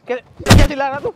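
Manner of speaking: loud burst of angry challenge; angry shout. Real, not acted. Bystander scolding a worker for shouting, angrily. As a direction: confront him at full volume.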